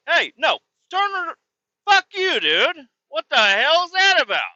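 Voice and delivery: rising into high, loud angry yelling; angry rant. Retold or imitated. Ranting at the guy who insulted his chew, angry yelling. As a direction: let it build into a furious outburst at him.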